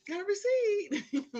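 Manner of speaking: high, loud and slow, proudly offered; cheerful loud announcement of the receipt; eager receipt girl. Retold or imitated. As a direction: hold it up like a prize, slow and bright. Her own eager receipt answer.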